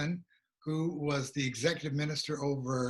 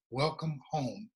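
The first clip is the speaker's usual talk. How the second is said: softer and slow, full of emotion; moved, hushed welcome; 3 semitones lower, 5 dB quieter, slower than usual. Retold or imitated. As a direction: let the words sink in slowly. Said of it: "First time in my life I'd ever been told".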